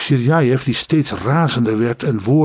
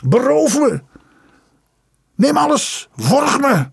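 high, strained cries, much higher; frenzied shouting; 13 semitones higher than usual. Acted. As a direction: shout in a voice that is not his own. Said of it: "met een stem die niet van hem was".

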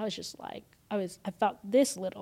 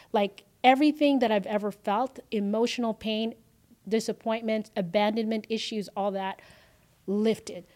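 ordinary delivery, nothing marked

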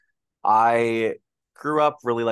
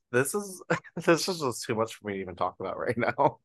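half-laughing, too moved to go on; overwhelmed, laughing; 2 semitones higher than usual. Retold or imitated. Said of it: "And I'm just like".

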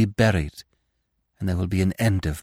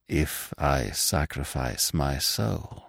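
slow, soft, lower and hesitant; slowly; 7 semitones lower, 6 dB quieter, slower than usual. Acted. Narrator answering the ghost, slow and hushed. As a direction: weigh each word, slow and hushed. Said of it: "I said slowly".

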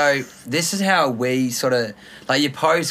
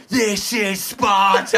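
slow, drawn-out war cry; movie battle cry; 5 semitones higher, much slower than usual. Retold or imitated. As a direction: quote the Spartan king. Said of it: "Have you ever seen the movie three hundred?".

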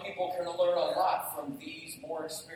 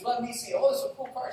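put-on higher, lighter girl's voice, a bit louder; imitating young daughter; 8 semitones higher, 4 dB louder than usual. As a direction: play the eager kid taking over. Friend's daughter fixes car.

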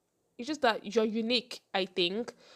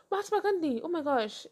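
ordinary talk

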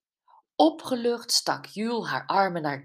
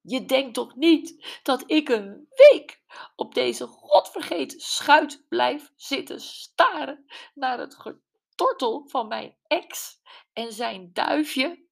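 higher, much louder, indignant outburst; indignant Gwen; 9 semitones higher, 7 dB louder than usual. A narrator's reading. Gwen railing to Brenda about her ex on the ship, indignant. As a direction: let the outrage spill over.

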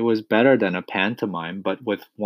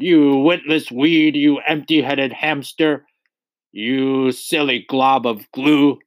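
slow, higher-pitched bellowed insults; bellowing; 5 semitones higher, 5 dB louder than usual. A narrator's reading. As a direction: bellow each insult, drawing out the words. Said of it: "the Trunchbull bellowed".

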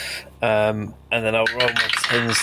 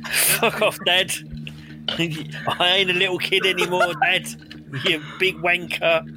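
angry at dad; 7 semitones higher than usual. Acted. Player yells at father character.

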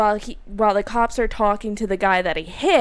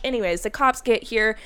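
usual speaking voice

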